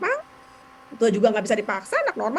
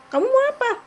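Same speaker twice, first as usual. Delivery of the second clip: tanya dengan nada jauh lebih tinggi; menirukan ibu bertanya; 5 dB louder than usual. Retold or imitated. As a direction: tiru ibu bertanya ke anak yang rewel. Dialog ibu dan anak. (menirukan ibu, nada sangat tinggi)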